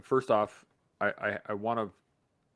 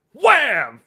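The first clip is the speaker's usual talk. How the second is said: higher, much louder impact word; loud wham; 13 semitones higher, 15 dB louder than usual. Retold or imitated. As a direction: slam it out like hitting concrete. Falling face first.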